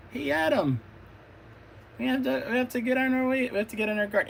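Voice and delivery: high, fast urgent call; rally the team like a leader; Kayla's voice. Acted. Kayla voiced calling Adam.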